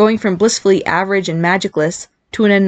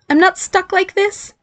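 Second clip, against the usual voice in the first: high, slow, worried question; desperate; 12 semitones higher, slower than usual. A narrator's reading. Barry fears he's stuck as a girl, desperate. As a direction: plead for reassurance in a squeaky voice. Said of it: "he demanded desperately".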